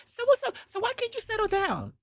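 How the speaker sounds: high put-on woman's voice, teasing the little homie. Girlfriend teasing her man's little brother, high voice.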